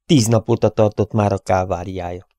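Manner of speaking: ordinary delivery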